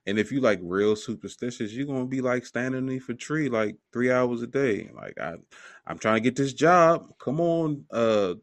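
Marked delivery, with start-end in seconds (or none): 5.2-8.3 higher, louder begging to a bird; hammed-up prayer for luck; mock pleading. Superstitious person begging a bird for luck, mock pleading higher and louder.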